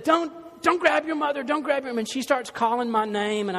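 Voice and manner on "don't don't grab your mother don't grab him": high, loud fatherly shout; a dad yelling down from upstairs; retold yell. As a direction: bark at the boys to stop.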